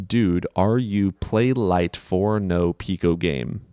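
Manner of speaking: slow, deliberate reading, word by word; careful reread. Real, not acted. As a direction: read each word out carefully and slowly. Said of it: "let me try and read this again".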